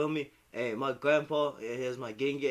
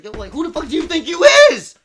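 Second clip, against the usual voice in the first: high, loud mock outburst; angry teen self; 21 dB louder than usual. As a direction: yell back like a furious teen. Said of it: "I used to always just be like".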